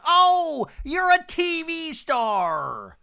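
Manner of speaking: hammed-up sarcastic Shannon; mocking sarcasm. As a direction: sneer it mockingly. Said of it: "Shannon goes".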